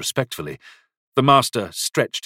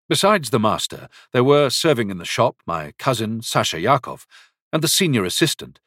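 ordinary delivery, nothing marked

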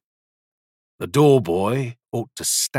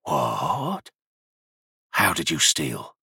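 low, soft, hushed horror; terrified whisper; 5 semitones lower, 4 dB quieter, slower than usual. A narrator's reading. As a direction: breathe it out in fear. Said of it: "as he whispered".

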